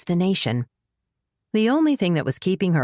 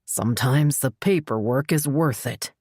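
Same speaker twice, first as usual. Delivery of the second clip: grim; 2 semitones lower than usual. Acted. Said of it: "he said grimly".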